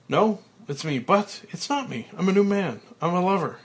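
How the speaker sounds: high, loud elated declaration; exuberant. Acted. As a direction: announce it with giddy joy. Frank transformed, exuberant.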